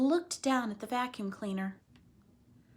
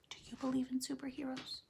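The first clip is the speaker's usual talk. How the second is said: whispered question to the class; 4 semitones higher, 9 dB quieter, faster than usual. Real, not acted. Teacher whispering a question to her listening class. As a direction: lean in and whisper it.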